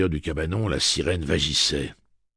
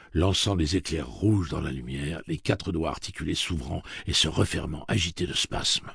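ordinary delivery, nothing marked

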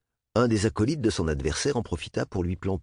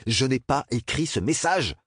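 shrieking denial; 6 semitones higher than usual. Acted. Bernard denies the message to the boys, high straining yell. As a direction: shout it until the voice strains. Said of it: "s'égosilla Bernard".

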